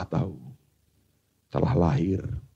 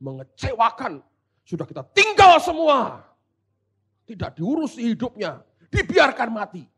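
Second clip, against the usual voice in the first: high-pitched acted grievance; aggrieved scolding, rising to an outcry; angry complaint; 6 semitones higher than usual. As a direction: complain bitterly as the abandoned disciples. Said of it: "Mereka marah. Yesus dihardik".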